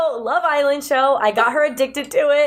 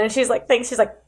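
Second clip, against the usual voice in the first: normal talking voice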